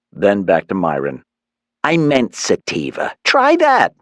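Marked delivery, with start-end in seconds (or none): 1.8-3.9 high mother's voice; pitched-up mom, correcting herself. A narrator's reading. Mother correcting herself to son, high voice.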